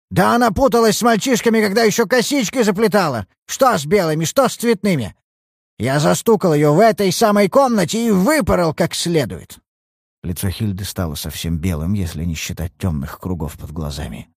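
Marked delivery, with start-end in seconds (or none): 0.1-9.6 put-on ranting old father. Old father telling the detective about his wild daughter, ranting. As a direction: spit it out in contempt.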